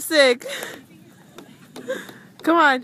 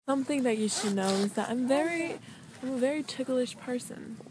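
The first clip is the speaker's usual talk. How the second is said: normal talking voice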